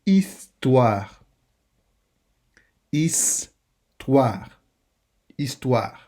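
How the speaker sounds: "Histoire" with silent h, ending in "twa"; French model word. Shown as a demonstration. Teacher drills French vocabulary aloud. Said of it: "repeat after me drill".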